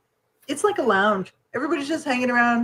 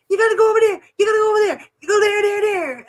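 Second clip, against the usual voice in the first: high, loud rapid-fire directions; brusque airport lady; 11 semitones higher, 4 dB louder, faster than usual. Retold or imitated. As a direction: imitate a harried worker shouting directions. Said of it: "she turns around and she goes".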